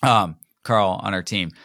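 normal talking voice

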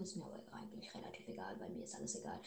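low breathy whisper. Pretend. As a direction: whisper it like a secret. Whispered careless aside.

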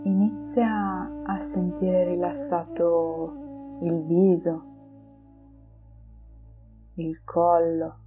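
slow, soft meditation voice; guided meditation. Acted. Leads a relaxation meditation.

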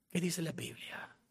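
breathy whisper with no voice. Real, not acted. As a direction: whisper the question as if it were a secret. Repeated question, voiced then whispered.

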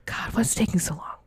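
unvoiced grumble; impatient whisper. Caller on hold whispering impatiently.

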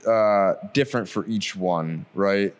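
ordinary talk

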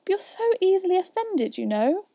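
high-pitched protest; imploring, lilting appeal; pleading. A narrator's reading. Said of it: "pleaded poor Alice".

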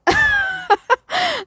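high burst of laughter; laughing. Real, not acted. Laughs at low phone battery.

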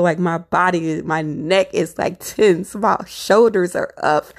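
ordinary talk, nothing marked

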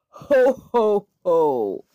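big booming laugh in words, falling at the end; triumphant bellow. A narrator's reading. Dragon bellowing triumphantly at the retreating knight. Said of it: "bellowed the dragon triumphantly".

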